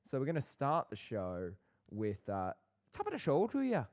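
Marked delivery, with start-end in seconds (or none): 2.9-3.8 high, far mock Irish lilt; chirpy leprechaun greeting. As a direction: segment name in a hammed-up Irish accent. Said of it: "like we're the Irishmen".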